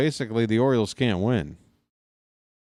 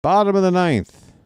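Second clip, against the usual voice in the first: much higher and louder, a big hyped-up call; dramatic announcer call; 10 semitones higher, 6 dB louder than usual. Acted. Calling the ninth inning for viewers, big hyped announcer. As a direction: announce it like a stadium showman.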